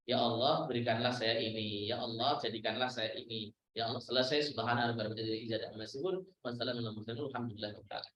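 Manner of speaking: low, soft, unhurried prayer; simple humble prayer. Retold or imitated. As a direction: murmur it low as a plain request to God. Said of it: "Tugas Anda cuma berdoa". (doa sederhana, suara rendah dan lirih)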